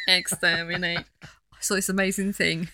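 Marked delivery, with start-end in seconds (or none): 0.1-1.0 harsh robotic Dalek. Acted. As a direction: bark it like a Dalek. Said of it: "crossword puzzle for Daleks".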